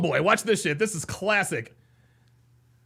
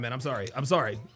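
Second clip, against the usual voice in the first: usual speaking voice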